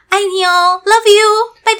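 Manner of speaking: imitating a high, slow, cooing goodbye; lovey-dovey sign-off. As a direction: stretch it out sweetly like ending a call to your husband. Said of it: "最后一句一定是". (模仿挂电话前拖长的撒娇道别)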